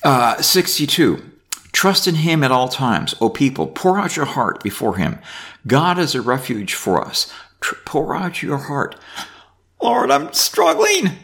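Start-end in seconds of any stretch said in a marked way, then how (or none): 1.7-7.3 raised, measured Bible reading; reading scripture aloud. Acted. Reads psalm aloud. 9.8-11.1 pretend prayer. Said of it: "You pour your heart out to him".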